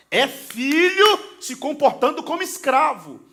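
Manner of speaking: loud, high, slow preaching with shouts; full-force preaching. Acted. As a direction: drive the message home to the congregation. Preaching climax.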